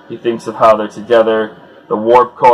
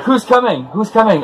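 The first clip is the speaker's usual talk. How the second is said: much higher, urgent repeated question; alarmed crowd; 10 semitones higher than usual. Retold or imitated. Bystanders spotting something incoming, voiced high and alarmed. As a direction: call it out like a nervous bystander. Crowd panics as someone flies in.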